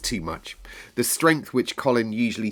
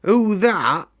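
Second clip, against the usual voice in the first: put-on higher, louder old gardener stunned; old man caught off guard; startled old Yorkshireman; strange shaky voice; 11 semitones higher, 7 dB louder than usual. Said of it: "strange, shaky voice".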